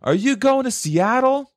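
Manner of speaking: very high, slow mock voice; slow, high-pitched goofy question; homeless man. Acts out homeless man asking.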